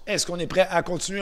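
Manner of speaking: rally the group, calling out with energy; energized question. Shown as a demonstration. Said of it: "Alors, on recommence". (question lancée fort pour motiver le groupe)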